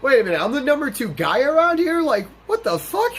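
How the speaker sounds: high-pitched, stunned protest; can't believe it's you, voice jumping up; incredulous. Retold or imitated. Acts out his disbelief at promotion.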